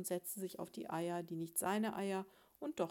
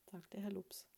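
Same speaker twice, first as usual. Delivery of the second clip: ordinary delivery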